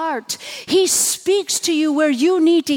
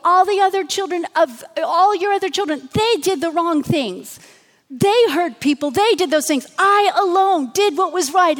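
higher and louder self-righteous complaint; Elijah complaining; 5 semitones higher, 4 dB louder than usual. Retold or imitated. Elijah protests to God that he alone did right, louder and indignant. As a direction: plead your case like you're the only good one left. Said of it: "He goes".

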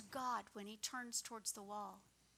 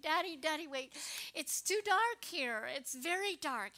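put-on high, louder child's plea in the dark; a frightened child pleading with her daddy at bedtime; scared little girl; 4 semitones higher, 7 dB louder than usual.

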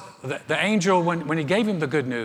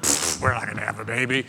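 lower, dismissive scoff; scoffing husband; 5 semitones lower than usual. Retold or imitated. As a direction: brush it off with a pfft.

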